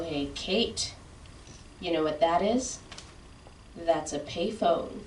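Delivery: lightly voiced dad, explaining slowly; dad-splaining. Dad pauses the movie to explain to his daughter, lightly voiced dad-splaining. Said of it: "and he'll go".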